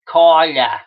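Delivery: "Carla" said like "Cahla", Northeastern; a light Northeastern "Carla", r dropped; Northeastern accent. Retold or imitated. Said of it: "doing the Northeastern accent".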